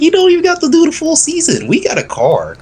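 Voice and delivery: high-pitched egging-him-on impression through laughter; mock coaxing. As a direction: play a pushy pal, voice leaping way up. Imitating drivers egging him on, laughing.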